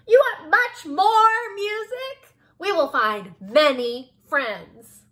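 loud, very high cricket voice; high-pitched, booming little cricket; eager, oblivious cricket. A narrator's reading. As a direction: squeal it helpfully, stretching the last words. Cricket misunderstands.

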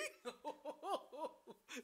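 high, soft, long giggle; helpless high tittering; giddy laugh. Real, not acted. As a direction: let a real giggle run on and on. Giddy at game starting.

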